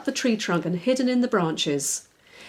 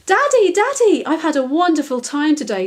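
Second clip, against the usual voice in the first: high, loud happy rush; girl running to tell her father; excited; 7 semitones higher, 6 dB louder than usual. Acted. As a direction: burst in with joy. Daisy runs in thrilled.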